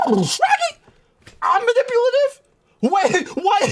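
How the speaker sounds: high hammed-up astonishment; mock disbelief. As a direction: act floored by being called manipulative. Stunned by the insult.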